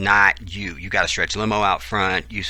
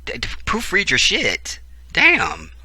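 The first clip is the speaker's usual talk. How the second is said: higher, stammering irritation at the text; exasperated outburst; 10 semitones higher, 3 dB louder, slower than usual. Real, not acted. Griping at the author about a typo, exasperated. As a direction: snap at the writer to proofread.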